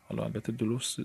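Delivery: ordinary delivery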